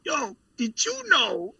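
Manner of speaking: slow, high stoned drawl; mock stoner wonder. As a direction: act out a baked friend. Retelling friends getting stoned.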